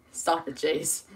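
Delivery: ordinary talk, nothing marked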